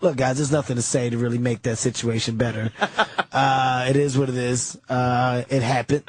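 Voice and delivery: low, deep Patrice voice; imitating Patrice. Patrice addressing the room afterwards, low deep voice. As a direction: drop way down into his calm deep voice. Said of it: "He said".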